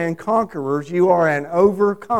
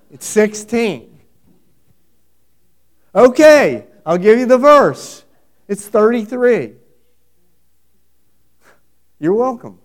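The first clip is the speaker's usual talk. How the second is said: louder and higher, playfully giving it away; teasing loud reveal; 4 semitones higher, 9 dB louder than usual. Real, not acted. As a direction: hand over the answer with a loud grin. Preacher teasing, giving verse.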